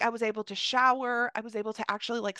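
normal talking voice

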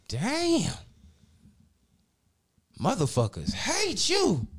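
high, drawn-out slow warning from a friend; high slow mock friend voice; friend's voice. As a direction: stretch it out like breaking bad news. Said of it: "he was like".

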